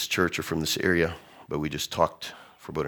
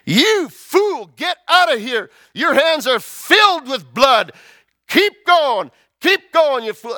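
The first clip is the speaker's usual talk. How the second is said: shrill, far higher and louder, furious yelling; screaming curses; 10 dB louder, slower than usual. Retold or imitated. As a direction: scream abuse from the ridge. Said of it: "Shimei is yelling and screaming".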